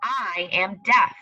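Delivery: put-on slow storybook reading to children; reading aloud. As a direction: read it slowly, like to little kids. Teacher reads story aloud.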